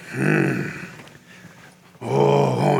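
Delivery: low preacher hum and moan; low, drawn-out warm-up noises. Retold or imitated. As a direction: imitate a preacher working himself up. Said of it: "he would go like this".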